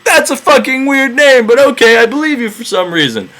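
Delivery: high, puzzled giant shrugging it off; gullible cyclops. Retold or imitated. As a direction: sound dim and high, buying the lie anyway. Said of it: "And Polyphemus is like".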